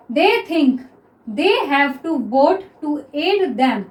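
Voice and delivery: each word drawn out slowly; slow dictation. Shown as a demonstration. Teacher dictates the next sentence to students, very slow. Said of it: "next देखिए".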